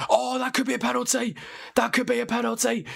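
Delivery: high-pitched excited gasp at the replay. Real, not acted. Streamer to chat spotting a possible penalty, excited high voice. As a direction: jump up in pitch, thrilled it might be a pen.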